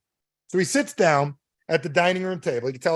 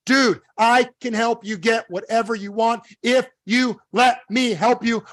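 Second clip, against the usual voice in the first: high, slow, word-by-word insistence; insistent plumber; 7 semitones higher, slower than usual. Acted. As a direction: spell it out to a doubter. Plumber insisting to doubting customer.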